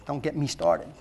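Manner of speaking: a quiet self-directed grumble; muttered aside. Real, not acted. Pastor warning himself about screens, quiet aside. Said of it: "Speaking to myself".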